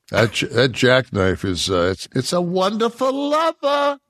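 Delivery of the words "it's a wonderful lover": high, silly put-on croon; hammed-up praise in a higher voice; playful put-on voice.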